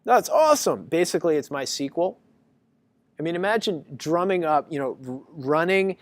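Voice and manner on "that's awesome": eager student. Retold or imitated. Lecturer imitating his student discovering databases, gleeful. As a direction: play the overeager student grabbing every service. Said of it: "he said".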